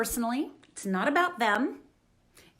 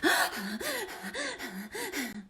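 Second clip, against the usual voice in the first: acted gasp; 4 semitones higher, 6 dB quieter than usual. Shown as a demonstration. Body panicking after quote.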